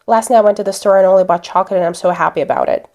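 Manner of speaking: fast, run-together words with no pauses; rushed recitation. Shown as a demonstration. Teacher showing the rushed wrong way to say it, very fast. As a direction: rattle it off like a memorized sentence, very fast. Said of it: "instead of saying".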